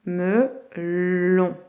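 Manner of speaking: Melon as me... llllon; split, drawn-out L. Shown as a demonstration. Teacher revealing where L sits, Melon with stretched L. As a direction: hold the L opening the second syllable of "Melon". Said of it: "où est-ce que j'entends L".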